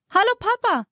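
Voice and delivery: high, happy call across the garden. A narrator's reading. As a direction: call out to her dad, delighted. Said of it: "ruft Thea".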